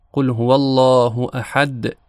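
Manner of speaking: احد ending in a slow bouncing د; qalqala on dal. Shown as a demonstration. Teacher reciting the verse to show the final bouncing dal. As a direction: let the final د of احد echo. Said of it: "دال در پایان آیهٔ اول".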